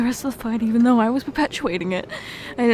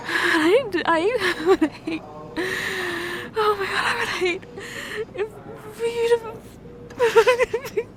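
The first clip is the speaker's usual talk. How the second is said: sobbing; 6 semitones higher, slower than usual. Acted. Confessing to her injured partner, sobbing. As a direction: voice cracking high through tears.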